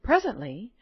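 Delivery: usual speaking voice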